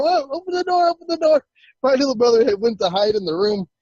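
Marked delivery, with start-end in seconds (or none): 0.0-1.4 frantic high-pitched begging to be let in; panicked plea. Retold or imitated. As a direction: re-enact desperate panic at a locked door. Banging on door, locked outside.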